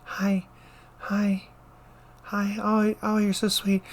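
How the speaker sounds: put-on high, soft, syrupy little old lady; sweet old lady voice. Nurse imitating the sweet ninety-year-old patient. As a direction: coo it like a sweet confused grandma. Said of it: "she's like".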